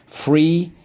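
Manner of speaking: high model word for students. Shown as a demonstration. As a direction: say it out for the class to echo. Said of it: "So let's go".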